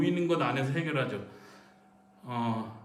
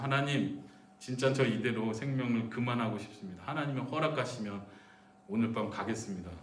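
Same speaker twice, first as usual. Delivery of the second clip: quiet, subdued personal prayer; softly surrender yourself to God; low, soft praying, fading at the end; resigned prayer; 4 semitones lower, 6 dB quieter than usual. Shown as a demonstration. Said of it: "그렇게 기도하십시오".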